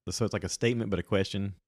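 "statement" and "question" lifted as if asking; Valley Girl uptalk. Shown as a demonstration. Podcaster explaining an annoying habit, mock upspeak on "statement" and "question". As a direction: make the statement a question, pitch rising on "statement" and "question". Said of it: "goes up at the end".

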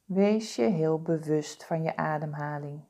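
normal talking voice

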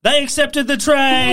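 high, loud announcement; excited reveal. Pretend. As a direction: announce it like a winner. Big reveal after walkout threat.

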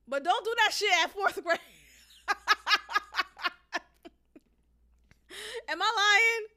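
real laughter breaking through high, loud words; loud, high and cracking up while talking; laughing. Real, not acted. Speaker warning against doing it too early, helpless laughing.

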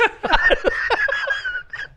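shrill breathless giggle, very high; high squealing laugh. Real, not acted. Can't keep it together.

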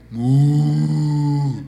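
imitated long, sustained moo; cow moo. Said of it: "¿qué se escuchaba?". (mugido de vaca imitado)

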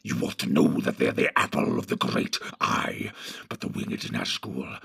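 a low menacing creature, scheming; Grishnak the sly orc. Acted. Grishnak speaks.

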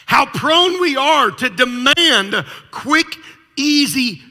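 preacher pressing the congregation, slow and loud; preaching. Real, not acted. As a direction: build the point and drive it home. Preaching climax.

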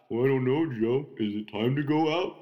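lightly voiced dog answering back. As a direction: give the family dog a voice. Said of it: "that's why I do the dog voice".

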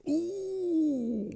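high, drawn-out "ooooh"; mock crowd ooh. Everybody reacts to a friend's return, mock crowd ooh. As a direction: hold a high, sing-out ooh like an impressed crowd. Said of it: "everybody's like".

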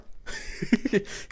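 high, brief giggle; laugh. Real, not acted. Laughing at own story.

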